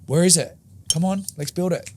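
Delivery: retold impatient self-pep-talk; impatient urging. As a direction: urge it on like you're done waiting.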